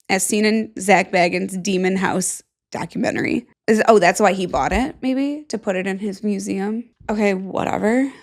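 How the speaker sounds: ordinary talk, nothing marked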